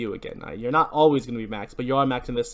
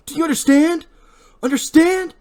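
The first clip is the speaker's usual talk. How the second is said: high shrill demanding yell; angry shout; 7 dB louder than usual. Acted. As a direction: bark the question. Barry cuts Max's cheek.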